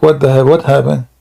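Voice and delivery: normal talking voice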